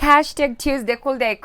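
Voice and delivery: ordinary delivery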